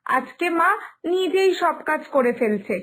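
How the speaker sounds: ordinary talk, nothing marked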